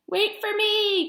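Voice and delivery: a hint of a gasping lady in waiting; breathless plea. A narrator's reading. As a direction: call after them, panicking. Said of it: "gasped the lady in waiting".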